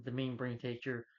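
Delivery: usual speaking voice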